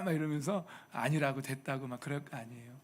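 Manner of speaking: ordinary talk, nothing marked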